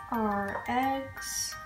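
ordinary delivery

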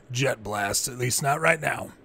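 usual speaking voice

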